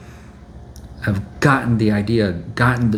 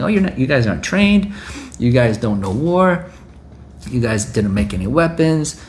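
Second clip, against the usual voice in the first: high mocking gloat, fading; taunting enemy; 6 semitones higher than usual. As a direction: sneer at the unready defenders.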